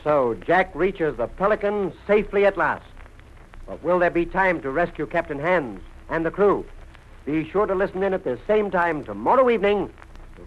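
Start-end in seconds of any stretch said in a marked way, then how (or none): none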